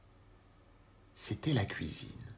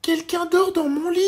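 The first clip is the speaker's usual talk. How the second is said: very high, loud little bear; a tiny cub crying out in alarm; Baby Bear; 9 dB louder than usual. A narrator's reading. Baby Bear shouts that someone sleeps in his bed, very high.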